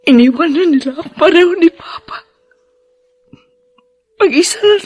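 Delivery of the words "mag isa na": higher, tearful cry of loneliness; starting to cry. Acted. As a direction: voice climbing higher into tears. Grieving daughter breaks down.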